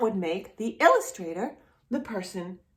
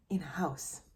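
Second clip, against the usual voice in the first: whisper; 4 semitones lower, 12 dB quieter than usual. Real, not acted. Reading the book title to a child, whispered. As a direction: drop to a whisper on the title's end. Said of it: "whispered end of title".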